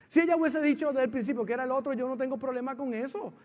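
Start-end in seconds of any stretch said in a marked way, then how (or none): none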